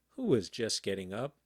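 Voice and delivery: plain reading voice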